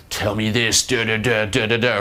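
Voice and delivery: mock barrage of orders; put-on caricature of a man giving orders; overdone bossy voice. Shown as a demonstration. Coach mocking a man over-ordering a girl, caricatured bossiness.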